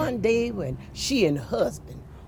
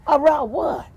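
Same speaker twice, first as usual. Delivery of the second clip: demanding; 7 semitones higher, 7 dB louder than usual. Acted. Wife demands impatiently.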